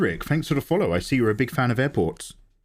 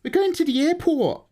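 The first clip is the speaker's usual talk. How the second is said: high voice. Pretend. High playful voice.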